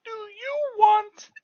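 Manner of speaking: high sing-song puppet question. Pretend. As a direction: coax the child as a puppet. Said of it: "teacher voicing puppet".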